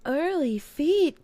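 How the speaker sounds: high, slow goofy call; silly drawn-out voice. Pretend. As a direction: stretch it out like a silly cartoon.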